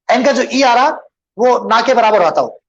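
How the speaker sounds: ordinary talk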